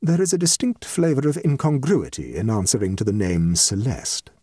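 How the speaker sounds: lightly voiced composed guest; dignified guest. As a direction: explain as a mildly rueful society lady.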